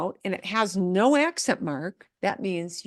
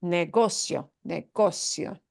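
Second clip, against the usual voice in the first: "negocio" punched on "go"; ne-GO-cio, second-to-last syllable stressed, falling; 2 semitones lower than usual. Shown as a demonstration. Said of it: "which is go".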